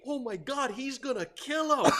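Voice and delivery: high-pitched mock panic; retold alarm. Classmates watching the teacher grab a boy, mock panic.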